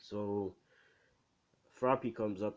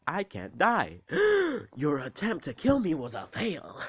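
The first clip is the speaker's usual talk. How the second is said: loud, high, hammed-up gloating; over-the-top comic bragging; joking taunt; 8 semitones higher, 7 dB louder than usual. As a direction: crow it theatrically, with a big mock gasp. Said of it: "he's just joking all around".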